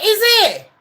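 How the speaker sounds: squeaky high mock sarcasm. Lawyer mocking the witness, high squeaky "is it". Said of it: "Camille's like".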